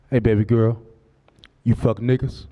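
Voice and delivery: low, smooth, direct come-on; smooth pickup line. Retold or imitated. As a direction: say it straight into her eyes. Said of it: "look her dead in her eyes and say".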